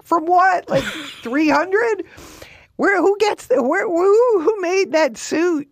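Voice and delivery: high, loud disbelieving squeal; incredulous high squeal; mock incredulous. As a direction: gasp out the questions in shock at the suit. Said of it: "And I was like".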